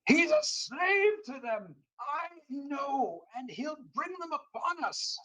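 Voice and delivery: high, furious rant; an old man furious about the slave; livid. Acted. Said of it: "He's so livid".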